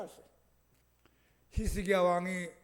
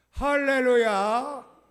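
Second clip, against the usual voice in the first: drawn-out hallelujah; 4 semitones higher, 10 dB louder, much slower than usual. Real, not acted. Preacher's climactic hallelujah.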